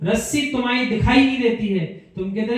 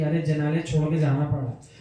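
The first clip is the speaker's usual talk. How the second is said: low, soft note of regret; mildly acted reluctance to leave; a touch of sorrow, voice sinking; regretful; 5 semitones lower, 4 dB quieter than usual. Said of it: "मन में खेद आना चाहिए".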